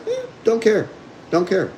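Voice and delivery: high, careless dog voice brushing off the collar; voicing the dog, dismissive. Trainer voicing a dog ignoring the e-collar, high and careless. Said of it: "Dog's like".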